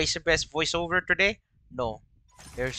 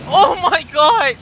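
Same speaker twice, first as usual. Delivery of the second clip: shocked exclaim; 10 semitones higher, 9 dB louder, slower than usual. Real, not acted. Racing game shock.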